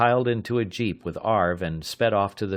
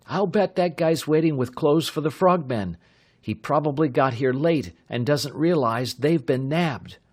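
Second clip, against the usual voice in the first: lightly voiced eager young man, pitched up; young pilot Bud; 7 semitones higher, 4 dB louder than usual. A narrator's reading. Bud explains his hunch to the sergeant, lightly voiced eager young man. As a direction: Bud sharing his hunch, keen and quick. Bud's voice in the story.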